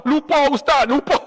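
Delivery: put-on old man begging the ustaz to forgive his sins; pleading pilgrim. Pilgrim forgot how to pray.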